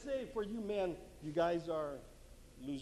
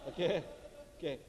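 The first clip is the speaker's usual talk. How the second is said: usual speaking voice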